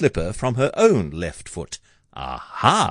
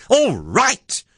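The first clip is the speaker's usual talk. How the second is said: high, indignant echo of the question; Cindy's exasperated, high cry; 14 semitones higher than usual. A narrator's reading. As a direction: throw it back as a high-pitched cry. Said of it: "cried Cindy".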